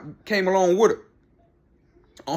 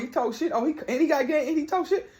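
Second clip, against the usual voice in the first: higher and much softer, mock impressed; lightly voiced admiring fan; mock hushed admiration; 7 semitones higher, 6 dB quieter than usual. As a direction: hush it like a fan in awe. Mocking fans admiring Larry Bird.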